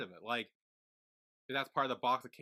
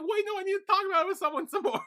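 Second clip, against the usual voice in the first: much higher and louder, frantic; frantic pleading; 6 dB louder than usual. Retold or imitated. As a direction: beg them to stay and listen. Sun holding Aileen, pleading.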